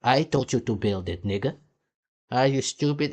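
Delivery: normal talking voice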